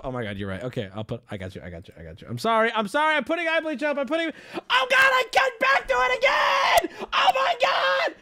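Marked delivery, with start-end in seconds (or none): 2.3-8.1 high, panicked shouting building to a scream; frantic panic. Real, not acted. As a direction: plead and shriek in horror. Frantic apology over disturbing video.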